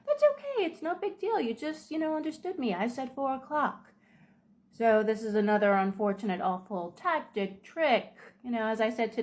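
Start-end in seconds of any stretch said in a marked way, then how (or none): 0.1-3.9 much higher, syrupy condescending; fake-sweet reassurance. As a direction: soothe them like they're a confused child. Manipulator falsely soothing the victim.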